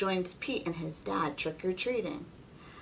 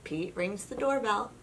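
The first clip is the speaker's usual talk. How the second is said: ordinary delivery, nothing marked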